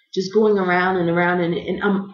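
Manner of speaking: usual speaking voice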